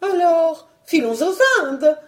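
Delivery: high, decisive, excitable wife; determined high-pitched baroness; insistent baroness. Acted. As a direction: announce the new plan, high and brisk. Excited wife proposes another country.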